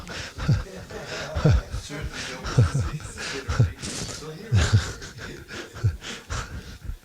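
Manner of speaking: breathy, drawn-out laughter fading away; long laugh. Real, not acted. A devotee laughing at his own airport story, long soft laughter. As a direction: let the laugh run out softly after the punchline.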